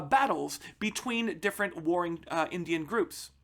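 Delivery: ordinary delivery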